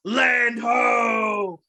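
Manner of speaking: lookout bellowing land ho; shouting from crow's nest. Pretend. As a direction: call it out like a sailor spotting land. Pirate lookout gag, shouting.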